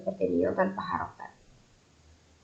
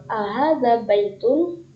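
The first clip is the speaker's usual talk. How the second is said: slow model question; 3 semitones higher, slower than usual. Shown as a demonstration. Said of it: "Misalnya yang ini".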